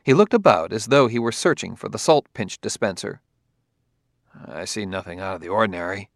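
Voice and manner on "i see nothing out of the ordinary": soft, quick, unconvinced denial; lowered, hurried and half-hearted; without conviction. Acted. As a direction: brush it off quietly, not believing it. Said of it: "said it without conviction".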